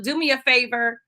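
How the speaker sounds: ordinary talk, nothing marked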